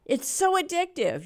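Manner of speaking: ordinary delivery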